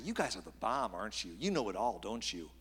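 low, dry sarcastic mock praise. Preacher paraphrases Job mocking his friends, sarcastically. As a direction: toss off false admiration, sinking on each tag question. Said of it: "says sarcastically".

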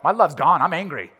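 hammed-up cranky traveler; retold grumpiness. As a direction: play your own bad mood for laughs. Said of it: "I was like".

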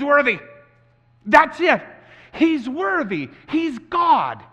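high, ringing sermon climax; impassioned preaching. Real, not acted. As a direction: proclaim it forcefully to the congregation.

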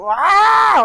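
sudden high loud mock baby wail; imitated baby wail. Preacher imitating a grandchild's wail, high mock cry. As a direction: wail like a newborn waking the house.

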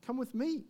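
high-pitched plea, leaning on "me"; stress on ME. Acted. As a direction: invite her, emphasizing "me". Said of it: "notice that the emphasis is".

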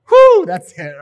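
loud laughing whew. Real, not acted. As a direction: laugh it off loudly. Laughs after dove joke.

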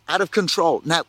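ordinary talk

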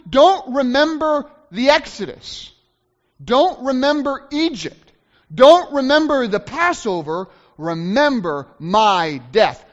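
loud, high, driving pulpit delivery; impassioned sermon climax, stretching the key words; full-force preaching. Real, not acted. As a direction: hammer each command home to the congregation.

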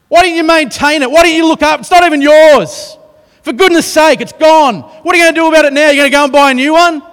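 high, loud ranting tirade, fast near the end; furious berating. Acted. Preacher acting out the angry boss he could be. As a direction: tear strips off the young man for losing the axe. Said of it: "He does not say".

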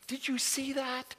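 high, thrilled "did you see that"; amazed excitement. Retold or imitated. Preacher grabbing the disciples after the calm, high and amazed. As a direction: gasp it out in delight.

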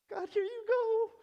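put-on very high, loud kid voice; eager child offering. Believer handing a gift back to God, high eager child voice. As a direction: offer it up like a proud little child.

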